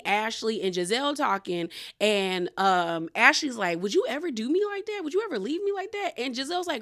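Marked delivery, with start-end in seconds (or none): 3.8-6.1 high, quick mock pleading; pleading castmate imitation. Host mimics castmate testing her friend's loyalty, pleading. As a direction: press the friend in a higher, hurried voice.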